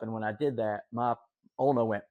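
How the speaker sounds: ordinary talk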